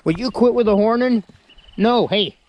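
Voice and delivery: high loud exasperated scold. Real, not acted. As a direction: tell off a butting goat. Goat butting him with horns.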